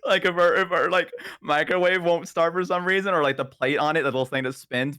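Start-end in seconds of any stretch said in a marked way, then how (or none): none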